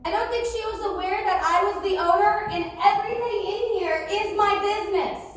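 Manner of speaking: usual speaking voice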